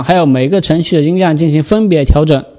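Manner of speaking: ordinary talk, nothing marked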